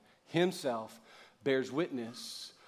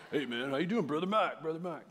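hammed-up tough-guy greeting; mock manly bro greeting, a touch higher; macho buddy voice; 3 semitones higher than usual. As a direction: play the friend who always tries to sound manlier. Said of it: "out-manhood you".

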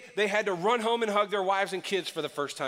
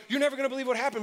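excited gossip; 7 semitones higher, much faster than usual. Retold or imitated. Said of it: "they're like".

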